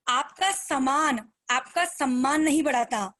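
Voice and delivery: slow, measured, every word weighed; slow key line. Shown as a demonstration. Speaker to her team, giving the key line slowly. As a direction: lay the maxim down slowly. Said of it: "बहुत कीमती line बता रही हूँ".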